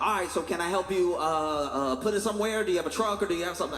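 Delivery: fast high-pitched retold nagging; hurried high mock plea. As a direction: pester them to hurry up, high and fast. Rushing the sellers, retold.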